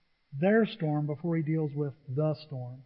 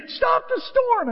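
much higher, slow and louder; panicked plea; 6 dB louder, slower than usual. Retold or imitated. Disciples begging sleeping Jesus, frantic high plea. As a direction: beg him to stop it. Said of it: "and they say".

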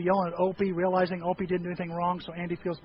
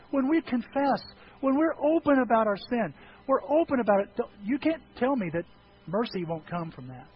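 usual speaking voice

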